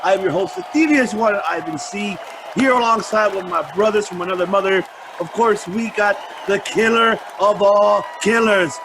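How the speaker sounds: loud, high announcer hype; booming old melodrama narrator. Acted. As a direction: ring announcer introducing the show. Host's booming podcast intro.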